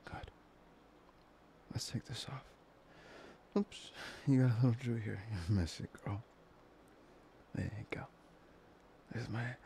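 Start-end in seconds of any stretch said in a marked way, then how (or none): none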